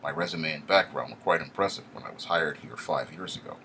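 ordinary delivery, nothing marked

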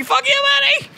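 squealing, very high mock taunt; mock furious game. Game insulting the player back, squealed mock fury. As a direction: escalate the taunt into a shrieky squeal. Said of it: "And it's like".

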